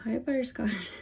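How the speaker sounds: soft and tender, like greeting a pet; a hushed, fond hello to the cat. Real, not acted.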